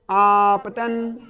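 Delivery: आपतन stretched very slowly, each syllable held; drawn-out term. Shown as a demonstration. Teacher prompting the class with आपतन, stretched long. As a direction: draw out आपतन for the class to repeat. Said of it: "उस बिंदु का नाम क्या होगा?".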